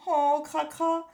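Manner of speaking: put-on a timid little boy in trouble; small voice. A narrator's reading. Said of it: "d'une petite voix".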